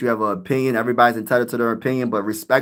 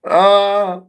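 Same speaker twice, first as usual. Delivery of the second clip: ordinary talk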